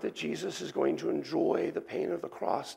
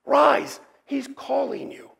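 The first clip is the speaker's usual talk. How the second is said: urging call; 10 semitones higher, 7 dB louder, slower than usual. Acted. Crowd urging the blind man.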